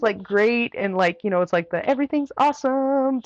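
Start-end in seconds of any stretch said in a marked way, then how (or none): 1.9-3.2 high-pitched sing-out of delight; mock cheeriness. Runner describing the race before it turned, mock cheeriness. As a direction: parody blissful optimism, pitched way up.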